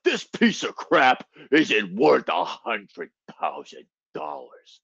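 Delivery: slow, growling wrestler impersonation; hammed-up monster wrestler voice; Braun Strowman. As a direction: growl it out slowly like a hulking brute. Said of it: "your best fucking Braun Strowman impersonation".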